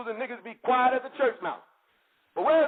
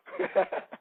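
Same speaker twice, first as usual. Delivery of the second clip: breathy unvoiced laugh; 4 dB quieter than usual. Real, not acted. Laughing retelling phone call.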